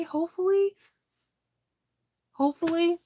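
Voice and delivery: hopeful high. Real, not acted. Hopeful sing-song repeat.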